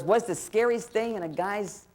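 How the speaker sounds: usual speaking voice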